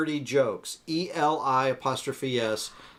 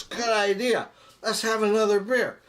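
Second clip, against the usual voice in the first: higher-pitched, lightly acted eagerness; joke-telling voice, pitched up in agreement; eager drunk; 7 semitones higher than usual. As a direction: chirp it like a tipsy pal in a joke.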